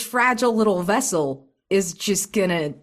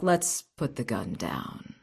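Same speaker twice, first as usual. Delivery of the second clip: calm; 6 semitones lower, 5 dB quieter than usual. Acted. Calming a gunman before a duel.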